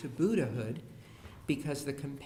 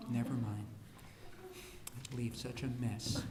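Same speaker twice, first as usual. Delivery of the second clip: put-on softer, lower, resigned child; quiet long-suffering mumble; mopey sigh; 3 semitones lower, 10 dB quieter than usual. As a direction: sigh it out like a martyr. Melancholic child sighs over mess.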